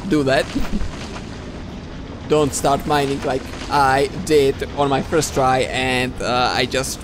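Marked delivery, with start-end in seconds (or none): 0.5-2.2 soft breathy laugh. Real, not acted. Laughing at own mistake.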